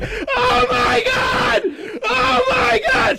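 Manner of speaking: high, drawn-out mock wail; screaming and sobbing. Woman behind him holding two children, imitated sobbing scream. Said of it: "screaming and sobbing".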